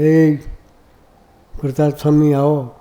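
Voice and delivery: mock holler summoning someone from the kitchen; calling out. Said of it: "પૂજારીને બૂમ મારીએ". (મોટેથી બૂમ પાડીને બોલાવતા)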